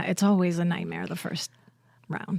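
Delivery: whispered, slowed inner voice. Retold or imitated. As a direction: hush it like a private dread. Self-talk quote after first take.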